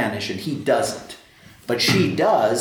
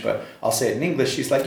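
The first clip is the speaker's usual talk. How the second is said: ordinary talk, nothing marked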